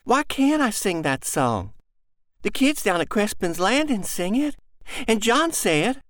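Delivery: put-on a small girl pressing her question; little girl. Girl pleads with mother.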